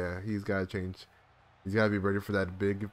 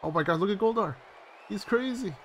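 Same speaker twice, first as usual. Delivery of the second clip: high-pitched, thrilled disbelief; excited amazement; 11 semitones higher than usual. Real, not acted. Viewer reacting to Goldar going crazy, excited amazement. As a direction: let the voice shoot up, awed.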